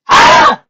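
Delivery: very high shriek; frustrated scream. Acted.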